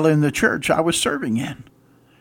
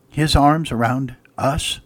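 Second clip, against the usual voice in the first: ordinary talk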